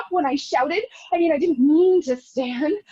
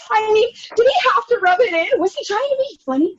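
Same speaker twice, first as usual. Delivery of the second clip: tearful outrage; 7 semitones higher than usual. Acted. Outraged rant about the waiter.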